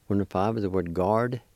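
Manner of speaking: normal talking voice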